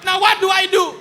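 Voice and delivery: high, loud panicked cry; panicked shout. Retold or imitated. Trapped man to the officer below, a high loud panicked shout. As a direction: plead down from the ledge.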